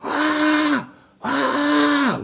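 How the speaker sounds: long, very high held shriek; mock hysterical screaming; imitated scream. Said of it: "Langsung teriak".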